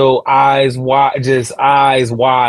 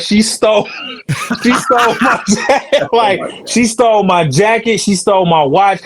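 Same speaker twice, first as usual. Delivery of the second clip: laughing through the outrage; laughing while retelling; 6 semitones higher than usual. Retold or imitated. Telling the homie in the hallway, laughing. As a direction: giggle out the stolen-jacket complaint, half amused.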